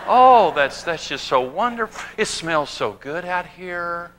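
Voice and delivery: soft, gushing mock-delicate voice; dainty Daniel Boone. Daniel Boone gushing over field flowers, softly. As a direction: coo over the flowers like a decorator. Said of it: "Daniel Boone stops and just says".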